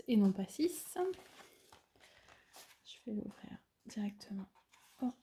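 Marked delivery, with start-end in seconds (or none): 2.8-4.5 soft whisper. Real, not acted. Whispers while opening box. 5.0-5.1 straining to open a box; opening a stuck jar. Real, not acted. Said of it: "c'est là que j'arrive pas à l'ouvrir".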